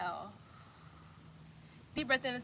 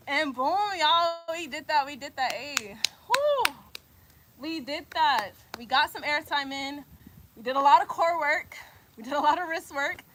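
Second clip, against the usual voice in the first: loud, pitched-up triumphant cheer; celebrating; 3 semitones higher, 6 dB louder than usual. Real, not acted. Coach cheering the class after crow pose, excited and celebrating.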